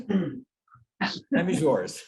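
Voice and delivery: normal talking voice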